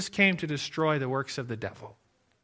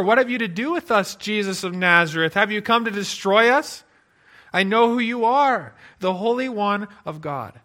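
demon crying out; 4 semitones higher, 6 dB louder than usual. Retold or imitated. Preacher voices the demon crying out.

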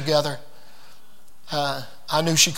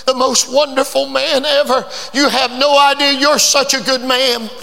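grateful exclamation; 7 semitones higher than usual. Retold or imitated. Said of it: "hugged him and said".

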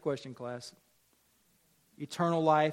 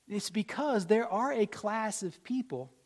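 ordinary delivery

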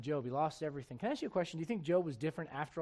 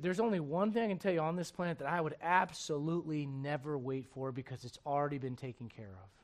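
ordinary talk, nothing marked

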